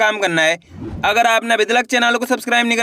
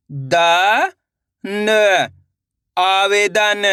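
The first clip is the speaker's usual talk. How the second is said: each syllable punched out alone, then the word drawn out slowly; slow sounding-out demo; much slower than usual. Shown as a demonstration. Teacher leading students to repeat syllables, slow and emphatic. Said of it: "मेरे साथ साथ इनको repeat करते जाना है".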